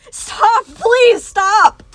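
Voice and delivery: high, loud anguished howl; begging her to stop, howling. Acted. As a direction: howl it in desperation. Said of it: "he howled".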